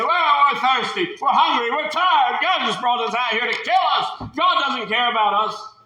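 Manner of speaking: high, whining grumble; whiny complaining. Acted. Israelites grumbling in the desert, high and whiny. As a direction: moan like tired ungrateful people.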